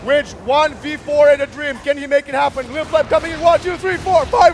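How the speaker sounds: yelling count to the kill; fighting game announcer. Real, not acted. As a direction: count up the kills, roaring. Counting the rampage kills.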